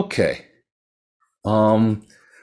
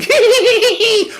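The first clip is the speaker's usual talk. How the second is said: much higher, louder giggling cackle; eerie ghost cackle; 7 dB louder, faster than usual. Acted. Faceless ghost laughs after the fleeing merchant, high eerie cackle.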